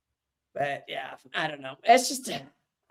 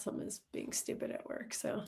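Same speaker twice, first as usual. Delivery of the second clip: breathy aside whispered to herself; whispering; 17 dB quieter than usual. Real, not acted. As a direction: mutter it in a whisper. Muttering about a computer problem.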